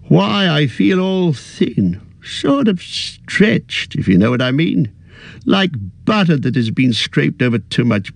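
put-on a fussy old hobbit, a little weary; old hobbit voice, tired at heart; Bilbo. Bilbo's voice in narration.

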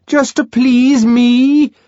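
high, loud, slow plea; bellowing wheedle. Acted. Bernard begging John through the door, loud and high. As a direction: wheedle at full volume. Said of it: "Bernard bellowingly wheedled".

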